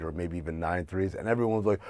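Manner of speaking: usual speaking voice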